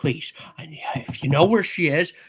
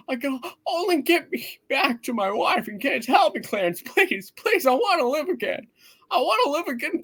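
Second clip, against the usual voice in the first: much higher, choked pleading to the angel; desperate begging; 11 semitones higher than usual. Acted. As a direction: cry out for the angel, begging to live. Man begs angel to take him back.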